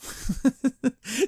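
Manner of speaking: high giggle of disbelief; real high-pitched laugh at something absurd; amused laugh. Real, not acted. Laughs at the lucky find.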